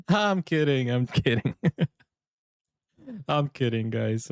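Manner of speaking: laughing through a disclaimer. Real, not acted. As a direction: say it through giggles after teasing. Laughing at his own banter.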